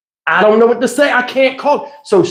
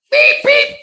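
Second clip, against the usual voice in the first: high mock beeping of a reversing forklift; imitating a truck backing up. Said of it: "beeping like a forklift".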